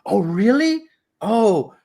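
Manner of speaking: high, rising retold reaction; imitated pleased surprise. Driver hearing tourist roots for Japan, imitated pleased surprise. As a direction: lift the pitch high as if suddenly won over. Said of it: "complete flip from this guy".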